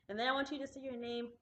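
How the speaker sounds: ordinary talk, nothing marked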